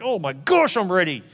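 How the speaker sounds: high outburst, leaping on gosh; mock desperate eagerness. Pastor acting the lover who can't wait, hammed-up eagerness. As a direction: blurt it out as if dying of impatience. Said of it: "They're dying. He's like".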